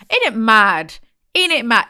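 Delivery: loud, rising exclamation of disbelief; loud outburst. Real, not acted. She cries out over the shoebox full of letters, loud and amazed. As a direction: belt it out, amazed.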